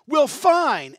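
sudden loud, high "Well, fine."; defensive snap. Retold or imitated. Hurt friend snapping back loudly in defence. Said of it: "and you're like".

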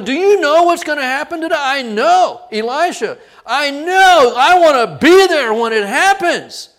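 high, loud, eager voices; breathless to see it happen; excited chatter. Retold or imitated. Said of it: "are going".

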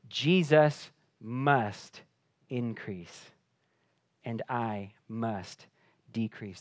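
drawn out, each word weighed; slow it down and let it land; slow emphatic. Real, not acted. Pastor repeating the motto to the congregation, slow and emphatic. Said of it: "slow emphatic repeat of the motto".

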